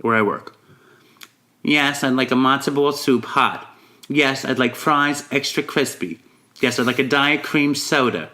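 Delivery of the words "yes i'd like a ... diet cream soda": Long Island accent ordering at a deli; measured Long Island order, pausing before each detail; Long Island woman. Shown as a demonstration. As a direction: impersonate a Long Island customer, item by item. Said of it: "Woman from Long Island ordering food".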